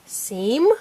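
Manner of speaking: SEAM short, with a rise; said fast. Shown as a demonstration. Teacher blending the sounded-out word, said fast.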